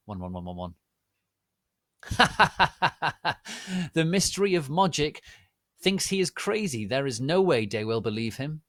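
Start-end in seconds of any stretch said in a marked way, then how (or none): 2.0-3.9 mean laugh. Acted. Laugh before Snoop's mean line. 3.9-8.6 higher, louder sneering taunt; villain sneer, fast; mean mocking. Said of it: "Snoop laughed meanly".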